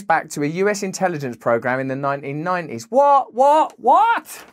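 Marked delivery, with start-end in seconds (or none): 2.9-4.4 louder, high-pitched disbelieving yelps; mock shock. Commentator reacting to Google's intelligence origins, mock shock. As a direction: repeat it, climbing higher in hammed-up astonishment.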